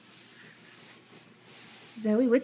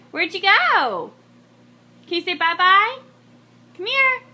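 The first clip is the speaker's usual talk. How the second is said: high, louder coaxing call; sing-song calling; 6 semitones higher, 7 dB louder than usual. Real, not acted. Mother calling her wandering toddler, high and sing-song.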